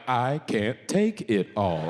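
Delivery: slow, low assistant voice; Siri. Acted. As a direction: draw it out like a lustful voice assistant. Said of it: "Siri's out here like".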